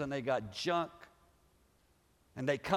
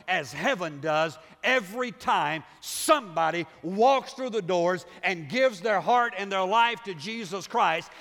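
full-force preaching, louder and higher; 6 semitones higher, 6 dB louder than usual. Acted. Pastor preaching hard to his congregation, loud and high. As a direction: drive it home to the congregation.

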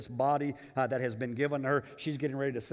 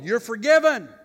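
much higher and louder, ringing out; triumphant shout; 8 semitones higher, 13 dB louder than usual. Acted. Pastor voicing Jesus to the congregation, triumphant shout. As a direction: shout the pardon as the climax. Jesus's repeated answer, climaxing.

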